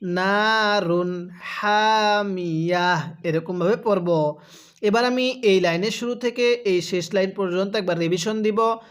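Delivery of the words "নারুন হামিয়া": নারুন হামিয়া drawn out slowly; teacher model reading, very slow; slow recitation demo. Shown as a demonstration. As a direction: stop on হামিয়া with a held ending. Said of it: "আরেকবার পড়ুন".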